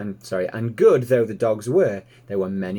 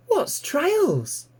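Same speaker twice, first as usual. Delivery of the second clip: eager piglet. Acted. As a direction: squeak it out like a curious piglet. Piglet Babe asks.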